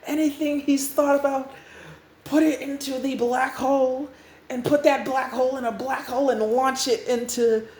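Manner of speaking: high, urgent commanding voice; panicked orders. Acted. New Restart orders robots to dump everything, panicked. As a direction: rattle out frantic orders.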